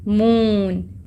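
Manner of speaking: मून slowly drawn out; a long vowel in मून. Shown as a demonstration. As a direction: hold the oo of मून. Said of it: "double ओ long है इसमें".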